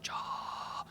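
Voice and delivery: long soft unvoiced hiss; static imitation. Passenger imitating intercom before the voices argue, static hiss.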